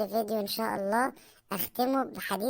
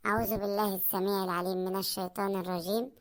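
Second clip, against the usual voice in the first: low, soft devout recitation; Quran recitation; 4 semitones lower, 4 dB quieter than usual. Acted. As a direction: recite the verse reverently.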